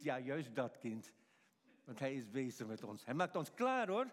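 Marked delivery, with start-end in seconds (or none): none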